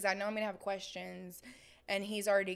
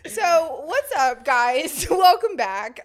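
hyped intro; 5 semitones higher, 12 dB louder, much slower than usual. Acted. Restarting the intro loudly.

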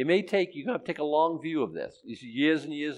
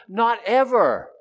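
ordinary talk

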